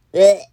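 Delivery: loud disgusted blech; gagging. Acted. A monster gagging after the kiss, loud blech. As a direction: gag it out loud. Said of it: "One gagged".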